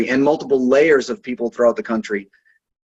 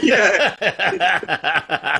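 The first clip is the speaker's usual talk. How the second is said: ordinary talk, nothing marked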